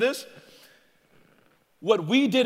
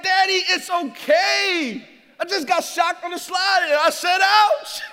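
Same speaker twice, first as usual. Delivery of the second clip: put-on higher, louder slow little-girl voice; calm Dad down as his child; daughter's voice; 9 semitones higher, 8 dB louder, slower than usual. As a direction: daughter reassuring her dad. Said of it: "she's like".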